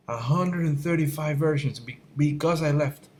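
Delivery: high-pitched, incredulous venting; exasperated rant. Real, not acted. Artist ranting about endless software versions, exasperated.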